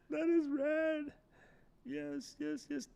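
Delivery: high-pitched thrilled cheer; excited squeal. Real, not acted. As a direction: squeal it out with glee. Delighted reaction on stream.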